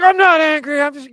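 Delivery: loud, high, indignant imitation; angry denial. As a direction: imitate a player yelling that he's calm. Angry player denies being angry.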